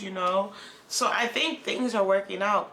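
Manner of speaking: ordinary talk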